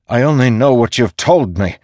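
high, loud, heated retort; mounting anger. A narrator's reading. Dalinar answering the storm god back, anger mounting. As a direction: throw it back with rising anger. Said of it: "feeling his anger mount".

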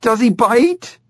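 put-on nervous thrilled girl; excited girl.

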